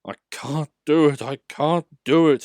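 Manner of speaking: an engine grumbling as his wheels slip. A narrator's reading. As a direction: complain through the effort. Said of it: "he grumbled".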